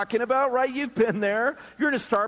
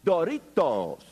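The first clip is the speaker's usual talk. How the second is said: Spanish Doritos; 5 semitones lower, slower than usual. Retold or imitated. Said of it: "how to say Doritos in the proper phonetic Spanish".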